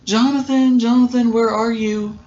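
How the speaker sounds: calling his name across the empty studio. Retold or imitated. Jessica calling out for Jonathan.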